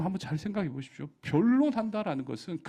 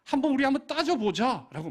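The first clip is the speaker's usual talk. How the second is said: raised, pleading voice of God; a God arguing his case, raised and urgent; God pleading with his people; 6 semitones higher, 5 dB louder than usual. Acted. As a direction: plead with them as an exasperated God. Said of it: "말하는 거예요".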